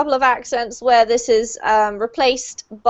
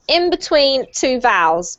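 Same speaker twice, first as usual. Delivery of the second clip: usual speaking voice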